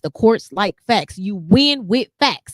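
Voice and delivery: slow, firm and deliberate, each word laid down; measured like a mother teaching; mom's lesson. Retold or imitated. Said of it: "my mom always taught me".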